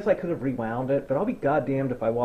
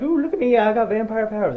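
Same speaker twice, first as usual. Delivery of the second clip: high, smug mock brag; mocking Bella; 7 semitones higher, 5 dB louder, faster than usual. Mocking Bella to the viewer, high smug show-off brag. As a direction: show off like a smug teen, pitched up. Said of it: "Bella's like".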